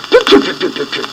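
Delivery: high peeps fading off; sulky chick peeping. A narrator's reading. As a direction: peep back, unwilling. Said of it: "Pip-Pip said".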